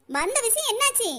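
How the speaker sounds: ordinary talk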